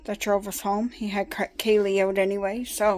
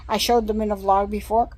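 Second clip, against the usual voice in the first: ordinary talk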